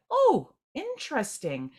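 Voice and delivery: high, lifted "oh, interesting"; light discovery in the voice; pleasant surprise. Retold or imitated. Meditator noticing a new connection with surprise.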